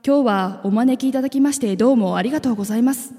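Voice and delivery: usual speaking voice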